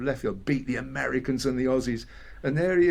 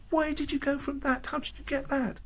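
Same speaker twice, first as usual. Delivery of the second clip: much higher, marveling at each track; amazed; 14 semitones higher than usual. Retold or imitated. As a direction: sound dazzled by the songs. Quoting amazed listener reaction.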